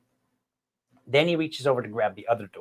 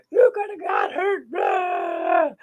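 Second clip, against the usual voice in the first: put-on a much higher, squeaky yell at the kid; high wimpy yell; much slower than usual. Dad scolding his son after the fall, squeaky high yell. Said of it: "I'm kind of sorta yelling at him".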